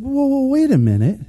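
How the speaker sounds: high-pitched, flustered protest; playfully startled objection; mock bafflement. Imitates flustered driver's reaction.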